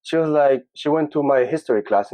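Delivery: ordinary delivery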